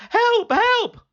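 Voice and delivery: high, loud yell for help; high, loud fake-alarm yell; shouting for help. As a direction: call out in alarm to the village. Second false alarm.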